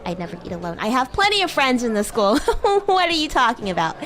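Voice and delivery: ordinary talk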